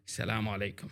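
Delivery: low, soft salaam greeting; greeting variation. Retold or imitated. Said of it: "different variations of salam".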